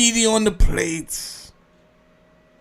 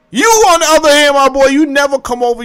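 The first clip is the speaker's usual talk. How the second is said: loud, fast, much higher scolding; calling someone out; 8 semitones higher, 6 dB louder, faster than usual. Acted. Telling off a friend who only talks crazy, loud and fast. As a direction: call your buddy out, fast and loud.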